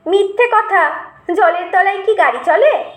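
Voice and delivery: put-on mildly jeering boy; mocking sneer. A narrator's reading. Boy taunting the old servant, mocking. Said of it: "আমি ভেংচি কেটে বললাম".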